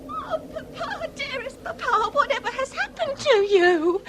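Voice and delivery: grieving over her wounded father; tearful lament. Acted. Daughter kneeling by her stricken father, tearful.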